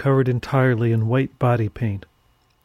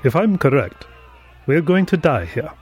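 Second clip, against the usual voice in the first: solemn priest, lightly voiced; Father Murphy, solemn; 3 semitones higher than usual. A narrator's reading. Priest telling caged companions their fate, solemn. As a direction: deliver grim news with gravity, in character. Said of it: "Father Murphy said solemnly".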